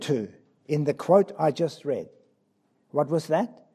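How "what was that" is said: usual speaking voice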